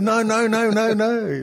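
high, rapid-fire string of denials; mock protest. Acted protest in the imagined scene.

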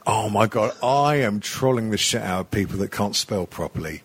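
ordinary talk, nothing marked

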